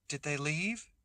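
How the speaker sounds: shy sister creeps back to ask if they've gone; soft voice. A narrator's reading. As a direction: ask it softly. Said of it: "Teresa's soft voice".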